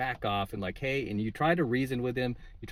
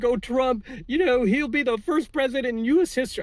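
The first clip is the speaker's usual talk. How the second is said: high-pitched parody of panicking lefties; mock them in a whiny, much higher voice; 11 semitones higher, 4 dB louder than usual. Vlogger mimicking freaked-out lefties, high mocking whine.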